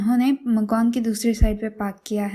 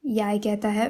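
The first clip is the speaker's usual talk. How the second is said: ordinary delivery, nothing marked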